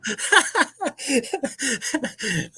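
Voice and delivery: burst of laughter. Real, not acted. Laughing at their sixteen viewers.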